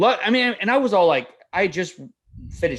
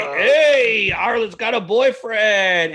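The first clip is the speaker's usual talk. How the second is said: loud, high, drawn-out taunt; stretched-out mock-gleeful announcement; teasing singsong; 6 semitones higher, 4 dB louder, much slower than usual. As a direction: crow it like a playground tease. Imitating Brock teasing the daughter.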